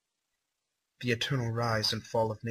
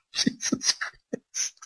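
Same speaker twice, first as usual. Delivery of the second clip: slow, breathless whispered disbelief; drawn-out awed whisper; stunned whisper; much slower than usual. Real, not acted. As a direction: barely breathe the words out. Reaction after a gory line.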